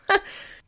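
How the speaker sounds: quick breathy laugh; a brief real laugh at the absurdity; short laugh. Real, not acted. Laughing at comments about hurt feelings, short real laugh. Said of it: "And I'm laughing".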